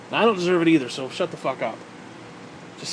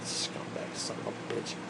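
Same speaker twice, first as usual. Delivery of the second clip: whisper; 15 dB quieter, slower than usual. Real, not acted. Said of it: "heard whisper".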